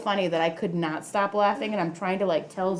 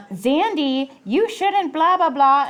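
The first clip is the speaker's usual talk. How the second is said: put-on higher, louder kid's nagging voice; raised-pitch tattletale whine; mimicking Penny; 7 semitones higher, 6 dB louder, slower than usual. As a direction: nag like a bossy big sister. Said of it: "Penny will be like".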